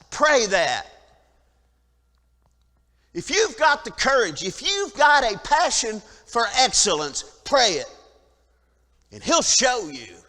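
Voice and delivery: loud, urgent preaching that drives each line home; preaching at full force. Acted. Preacher urging his congregation to pray boldly, loud fervent preaching. Pastor preaching on Paul, David.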